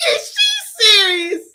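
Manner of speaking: high, slow, incredulous cry; real astonishment, almost shouting; shocked disbelief. Real, not acted. Host reacts to shocking clip.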